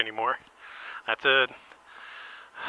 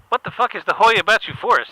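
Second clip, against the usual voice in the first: high, loud, baffled yell; incredulous shout; 9 semitones higher, 10 dB louder than usual. Retold or imitated. Brian baffled by the host's question, yelling. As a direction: shout it in disbelief. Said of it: "He said".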